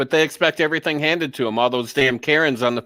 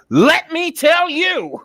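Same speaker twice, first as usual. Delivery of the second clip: slow, high scolding cry; aunt jumping down his throat; 13 semitones higher, 4 dB louder, much slower than usual. Retold or imitated. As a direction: stretch each word into a high, fierce telling-off. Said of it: "she jumped down my throat".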